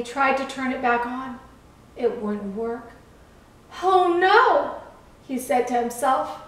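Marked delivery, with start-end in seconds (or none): 3.8-4.8 high, louder cry of dismay; worried storybook gasp of words. A narrator's reading. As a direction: realise with alarm it went wrong. Lighthouse finds light broken.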